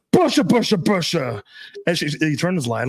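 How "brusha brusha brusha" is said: high-pitched mock Russian scolding; imitated loud Russian. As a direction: imitate an angry girlfriend's rapid gibberish Russian. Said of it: "I've never heard her speak so loud".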